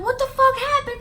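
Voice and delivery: high, loud alarmed mother; alarmed mom. Retold or imitated. Mom asking her daughter what happened, high and loud. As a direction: voice mom demanding answers, up high.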